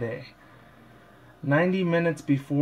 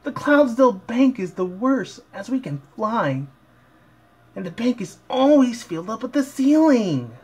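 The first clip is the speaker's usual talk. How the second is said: Rainbow Dash voice; 9 semitones higher than usual. Acted. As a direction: complain in a high girly cartoon voice. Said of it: "Dash raised an eyebrow".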